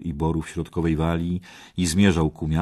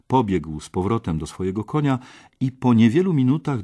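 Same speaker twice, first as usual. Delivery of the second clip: ordinary delivery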